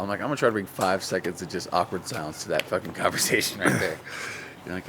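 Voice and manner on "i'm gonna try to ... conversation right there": mock awkward-comic voice. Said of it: "like Andy Kaufman style".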